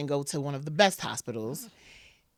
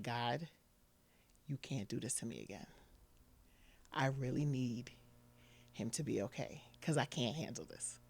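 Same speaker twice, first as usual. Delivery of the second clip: soft, pleading words to God, close to tears; hushed plea, barely holding it together; quiet desperate prayer; 4 semitones lower, 13 dB quieter than usual. Retold or imitated. Alone in the work bathroom begging God, hushed and pleading.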